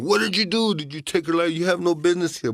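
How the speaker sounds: high, loud storyteller's take on a furious brother; angry accusation. Acted. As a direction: accuse him as if he has killed her. Brother confronts Turtle carrying her.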